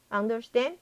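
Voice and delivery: normal talking voice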